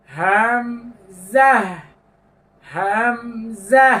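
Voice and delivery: slow deliberate হামজা, no lengthening; letter name Hamza. Shown as a demonstration. Teacher demonstrating হামজা for students. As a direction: say হামজা clean and unstretched. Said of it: "কোনো লম্বা হবে না".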